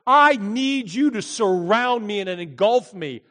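loud, high cry for help; desperate prayer. Retold or imitated. A person in a storm at sea crying out to God, loud and high. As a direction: cry out to God as the boat sinks. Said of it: "Your prayers are".

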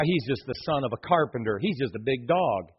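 low, soft belittling aside; acted dismissiveness. Hometown people talking about Jesus, quietly dismissive. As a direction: imitate townsfolk shrugging him off, voice dropped.

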